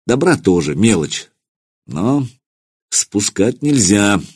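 ordinary delivery

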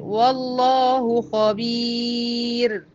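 خبير ending on a thin, light ر, chanted very slowly; slow Quran recitation stopping on خبير; light ra at stop. Shown as a demonstration. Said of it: "هرقق الر".

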